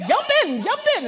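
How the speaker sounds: high, loud guide calling jump in; a loud high tour guide call. Retold or imitated. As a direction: shout it like an eager tour guide.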